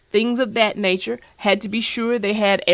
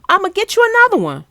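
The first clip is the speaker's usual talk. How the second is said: rushed repeat; 10 semitones higher, 4 dB louder, much faster than usual. Real, not acted. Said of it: "Wait, what?".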